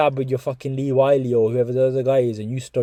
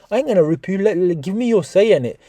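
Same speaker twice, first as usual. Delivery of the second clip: normal talking voice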